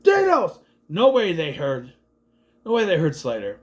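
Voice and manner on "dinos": very high shout of "Dinos!"; mock yell. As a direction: yell "Dinos!" as loud as a cheer. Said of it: "Pine is like".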